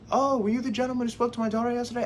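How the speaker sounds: put-on high, quick old-man voice; lightly caricatured old fellow; elderly man. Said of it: "I see an elderly guy".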